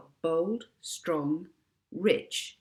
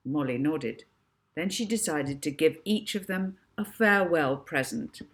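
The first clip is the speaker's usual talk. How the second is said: ordinary delivery, nothing marked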